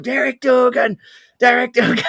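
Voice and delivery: high, loud mock drunken yell; imitated shouting. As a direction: shout the name over any reply, like an aggressive drunk. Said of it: "He just shouted at me".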